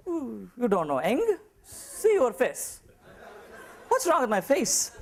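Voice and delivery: high, slow mock-indignant; offended [name]. [name] takes the compliment as an insult, mock indignant.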